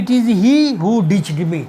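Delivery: very slow, each word laid out; slow emphatic model sentence. Shown as a demonstration. Teacher modelling a sentence for students, slow and deliberate. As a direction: spell it out slowly for the learners. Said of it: "एक और example".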